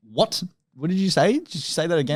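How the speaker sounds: confused asking. Retold or imitated. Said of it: "And I'm like".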